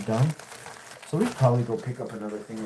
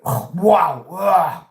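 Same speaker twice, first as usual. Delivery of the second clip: loud, raised exclamation; sudden outburst; 5 semitones higher, 10 dB louder, much slower than usual. Real, not acted. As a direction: burst out loudly mid-thought. Sudden outburst mid-sentence.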